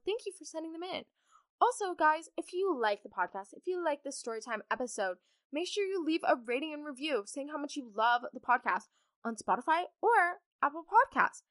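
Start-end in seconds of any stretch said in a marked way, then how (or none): none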